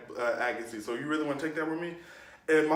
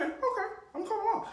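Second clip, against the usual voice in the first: much higher, sing-song and smug; mock compliance; faster than usual. Kid mocking teacher's order, high cheeky sing-song. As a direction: play along in a squeaky, cheeky voice. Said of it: "I was like".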